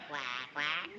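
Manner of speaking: very low double quack; duck-call imitation. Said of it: "you were making those calls".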